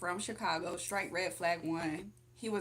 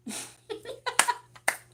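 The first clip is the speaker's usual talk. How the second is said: high, loud burst of laughter; real high-pitched giggling fit; 6 dB louder than usual. Real, not acted. Laughs after failing to explain.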